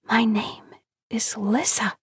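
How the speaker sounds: breathy timid whisper into the dark, slow and high. Acted. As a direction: barely voice it, afraid to be heard. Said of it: "she risks whispering to the dark".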